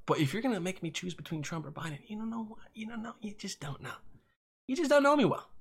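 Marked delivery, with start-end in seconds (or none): 0.1-3.9 hushed, unvoiced whisper. Real, not acted. Podcaster whispering a confession after apologising for yelling.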